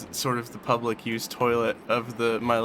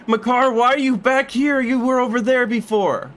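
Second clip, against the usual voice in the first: high shriek; 10 semitones higher, 5 dB louder than usual. Real, not acted. Shrieking at game character.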